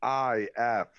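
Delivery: slow letter-by-letter spelling; spelling out slowly. Shown as a demonstration. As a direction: spell each letter deliberately. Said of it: "Can you spell that".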